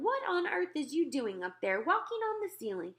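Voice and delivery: high, indignant big-sister yell; lightly acted scolding yell; mild mock yell. A narrator's reading. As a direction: read her yelling with rising pitch, not full volume.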